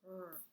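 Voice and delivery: R as a soft held "rrr", not the letter name; drawn-out quiet "rrr" for R; letter-sound demo. Shown as a demonstration. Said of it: "W R says".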